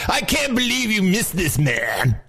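high-pitched put-on complainer; mock complaining listener. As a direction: imitate an indignant fan in a high voice. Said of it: "I hate it when people say that".

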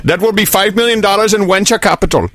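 put-on playful Indian accent; mock Indian accent. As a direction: pitch it as an Indian investor. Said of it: "that accent".

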